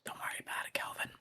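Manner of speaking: soft whisper, teasing reassurance. Pretend. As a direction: breathe it out, no voice.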